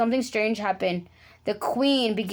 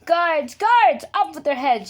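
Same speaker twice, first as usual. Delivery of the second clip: higher, louder furious order; tiny angry shout; 6 semitones higher, 5 dB louder than usual. A narrator's reading. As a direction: scream for the guards in a rage. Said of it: "she shouted".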